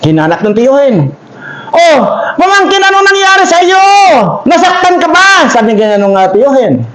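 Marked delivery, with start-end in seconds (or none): 1.7-5.5 high, anxious questioning; worried uncle. Acted. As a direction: rush to the hurt boy. Uncle finds hurt nephew.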